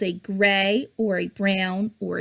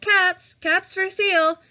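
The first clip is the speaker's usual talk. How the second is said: high, sing-song street cry of a cap seller; higher peddler's street call; 8 semitones higher than usual. A narrator's reading. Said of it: "he called".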